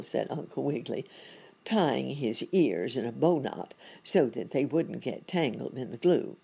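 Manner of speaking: plain reading voice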